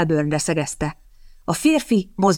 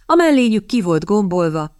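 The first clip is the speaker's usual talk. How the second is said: plain reading voice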